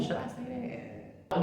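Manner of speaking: dropped to a soft, hushed aside; half to herself, wondering if she should share it; quiet aside. Real, not acted. She hesitates before naming mom's job, asking herself quietly.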